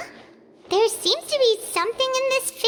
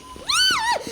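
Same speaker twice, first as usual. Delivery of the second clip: startled yelp; 12 semitones higher than usual. Acted. Paimon startled in midair.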